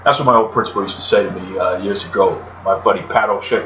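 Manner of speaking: usual speaking voice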